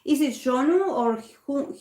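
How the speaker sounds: ordinary talk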